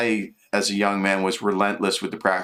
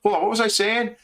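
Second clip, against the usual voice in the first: mock partner; 10 semitones higher than usual. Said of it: "and they stop, so".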